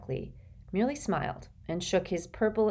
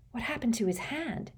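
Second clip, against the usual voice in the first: hushed, high gasp of shock; understated shocked question; 7 semitones higher, 4 dB quieter than usual. A narrator's reading. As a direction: ask in startled, high-pitched alarm. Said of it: "gasped Hermione".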